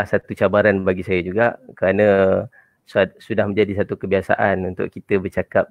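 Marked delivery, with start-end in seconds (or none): none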